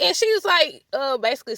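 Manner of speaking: usual speaking voice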